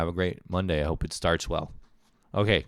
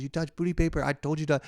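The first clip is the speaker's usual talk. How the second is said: high-pitched imitation of a teasing partner; mock them in a high sing-out voice; retold gleeful I-told-you-so; 10 semitones higher, 5 dB louder than usual. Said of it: "she starts kinda joking on me".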